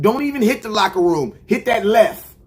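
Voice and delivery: usual speaking voice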